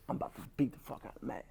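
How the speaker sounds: fast, breathy whispered threat; sneaky whisper. Pretend. Resentment creeping up, whispering a threat to beat Matt.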